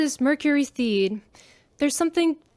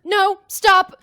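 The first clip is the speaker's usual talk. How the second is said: louder, higher hammed-up frightened cry; mock panic; 6 semitones higher, 7 dB louder than usual. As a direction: fake terror to sell the hostage story. Pretend hostage screaming at officer.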